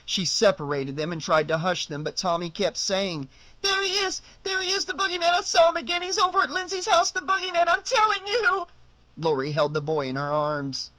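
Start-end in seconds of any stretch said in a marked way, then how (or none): 3.6-8.7 put-on high, panicky child pleading; insist, near tears, high and fast; frantic little boy. Boy insists he saw boogeyman.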